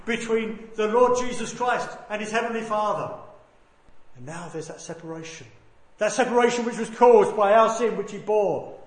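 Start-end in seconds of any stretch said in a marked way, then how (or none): 0.0-3.1 loud, emphatic sermon delivery; full-force preaching. Real, not acted. Preacher telling the church of Christ's suffering, preaching at full force. 6.0-8.8 loud, emphatic sermon delivery; full-force preaching. Real, not acted. Preacher telling the church of Christ's suffering, preaching at full force.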